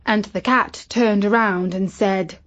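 ordinary delivery, nothing marked

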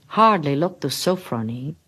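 usual speaking voice

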